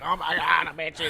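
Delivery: loud, high itchy panic; panicked yell. Retold or imitated. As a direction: yelp it like waking up itchy. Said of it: "or if I wake up just like".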